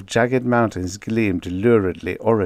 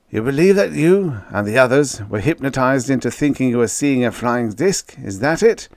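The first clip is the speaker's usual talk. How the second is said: dry; 5 semitones higher, 5 dB louder than usual. A narrator's reading. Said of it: "the general said dryly".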